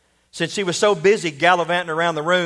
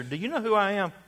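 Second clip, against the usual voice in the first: higher-pitched, lightly sly question; 5 semitones higher, 4 dB quieter than usual. A narrator's reading. Student challenging the professor who failed him, lightly sly. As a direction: ask it with a sly smile. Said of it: "The student smiled slyly and asked".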